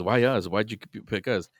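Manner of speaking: normal talking voice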